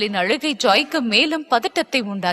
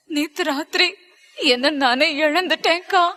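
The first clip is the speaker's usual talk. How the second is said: tearful, higher-pitched, halting; sobbing confession; 4 semitones higher than usual. A narrator's reading. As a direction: confess through tears. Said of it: "வெடித்து அழுத Stella".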